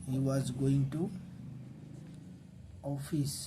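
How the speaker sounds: dictating clearly to students; slow dictation. Shown as a demonstration. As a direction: spell it out for the class slowly. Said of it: "teacher dictating the sentence".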